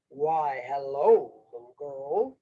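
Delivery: low-pitched wolf character. Acted. Wolf greets little girl in the woods, low wolf voice. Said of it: "said the wolf".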